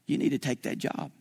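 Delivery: low, whispered command; a still small voice inside; hushed inner voice of God; God's quiet voice. Retold or imitated. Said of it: "I know it was God that said".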